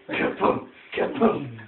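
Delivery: ordinary delivery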